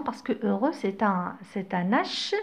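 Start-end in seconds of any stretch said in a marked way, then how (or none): none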